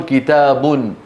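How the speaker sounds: كتاب ending in a sounded -un, kitaabun; nominative tanween. Shown as a demonstration. Teacher shows the indefinite noun, كتاب as kitaabun. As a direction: slowly add the nun ending -un to كتاب. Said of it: "إما مرفوع أو منصوب".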